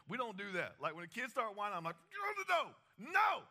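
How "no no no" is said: high, loud sing-song no; mock scolding no. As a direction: shut down whining kids with a shrill-free sing-song refusal. Scolding whining kids.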